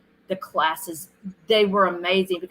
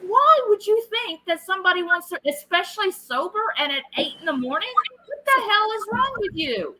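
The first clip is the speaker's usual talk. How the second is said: exasperated; 12 semitones higher than usual. Retold or imitated. Retold exasperated rant.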